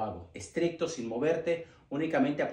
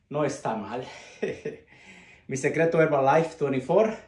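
laughing; 4 dB louder than usual. Real, not acted. Proud laugh about his age.

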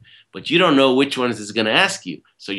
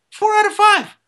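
"Four out of five" said much higher and louder; "Four out of five" echoed high and loud, stressing "out"; emphatic disbelieving repeat; 5 dB louder than usual. Shown as a demonstration. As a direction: jump way up in pitch to hammer the number. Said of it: "I was crashing four out of five times".

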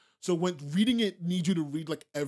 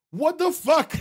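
high, loud disbelief; exasperated outburst; 9 semitones higher, 6 dB louder, slower than usual. Real, not acted. Streamer exasperated at a chatter, loud outburst. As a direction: throw up your hands.

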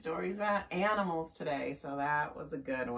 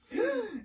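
high, sharp mock gasp; frightened gasp. Cat hearing the threat, high startled gasp. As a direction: gasp in story-time fright.

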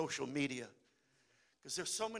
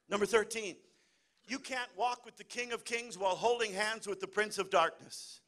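ordinary talk

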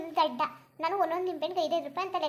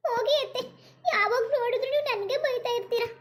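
hammed-up whimpering daughter-in-law, higher; mock crying; 7 semitones higher, 5 dB louder than usual. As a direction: whine through fake tears. Scolded daughter-in-law mock weeps. (ನಾಟಕೀಯವಾಗಿ ಅಳುತ್ತಾ)

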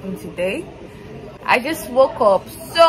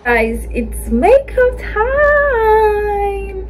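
sung-out announcement, much higher and very slow; excited announcement; 11 semitones higher, 4 dB louder, much slower than usual. Real, not acted. Vlogger to her viewers, drawn-out excited announcement. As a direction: stretch it out like a show opener.

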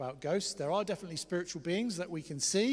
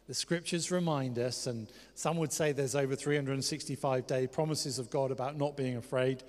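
ordinary talk

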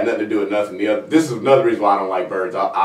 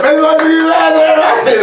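big emcee shout, much higher and louder; screaming make some noise at a club crowd; hype shout; 6 dB louder, much slower than usual. Retold or imitated. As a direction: pump up the crowd like a hype man. Acting as MC to the crowd.

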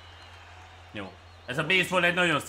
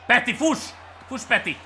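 raised, higher urging shout; urgent cheering; 8 semitones higher, 3 dB louder than usual. Real, not acted. Gamer urging Peti to run, urgent shout. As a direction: call out to spur the runner on.

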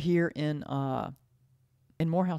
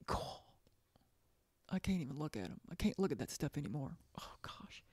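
real tears held back, much softer and half whispered; fighting off crying, barely voiced; near tears; 9 dB quieter, faster than usual. Real, not acted. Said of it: "I'm starting to cry when I think about it".

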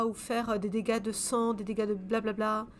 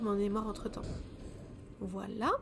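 usual speaking voice

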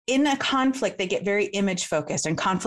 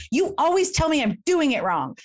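raised, exasperated complaint; critical accusation; 8 semitones higher than usual. Acted. Showing partners how not to criticize, accusing. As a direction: accuse with high emphasis on the grievance. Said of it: "not criticizing them, like".

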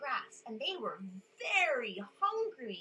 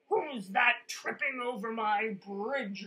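a louder roaring troll; 7 dB louder than usual. A narrator's reading. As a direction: boom it out as the mean troll. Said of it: "roared the troll".

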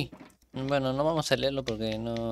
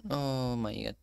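usual speaking voice

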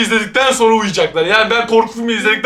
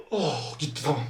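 ordinary talk, nothing marked

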